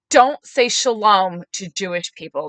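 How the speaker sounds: loud, slow reprimand; acted-out exasperated correction; firm retold scolding. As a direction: lay down the rule to mom.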